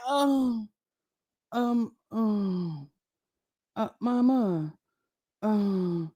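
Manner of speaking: put-on low, soft, hesitant stammering child; stammering kid asking mama. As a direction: mumble like a scared kid begging for money. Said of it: "and you be like".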